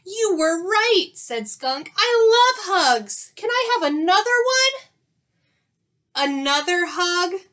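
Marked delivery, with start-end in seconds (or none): 0.0-1.1 high, loud wow; wowed storybook skunk; amazed delight. Acted. Converted skunk now loves hugs. 2.0-4.9 very high, loud glee; gush, then eagerly beg; squealing storybook delight; overjoyed skunk. Acted. Converted skunk begs for more hugs. 6.1-7.4 high, loud query; startled storybook bear; surprised question. A narrator's reading. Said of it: "asked Bear".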